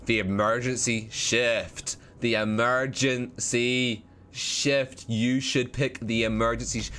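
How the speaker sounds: slow, deliberate, drawn-out words; measured, leading delivery; heavy hinting. Real, not acted. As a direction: spell it out slowly as a hint. Hints chat toward the choice.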